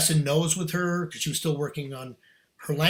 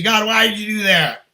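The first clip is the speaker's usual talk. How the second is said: loud, higher-pitched protest to God; mock complaining; 4 semitones higher, 7 dB louder than usual. Prophet complains to God after preaching, indignant. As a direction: imitate an indignant complaint to heaven. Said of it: "now you're complaining about that".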